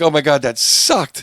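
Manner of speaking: loud, high, drawn-out mock dismay; slow, hammed-up disgust at your own set; mock despairing comic. Comic beating himself up after a set, mock despair. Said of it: "Or if you're like".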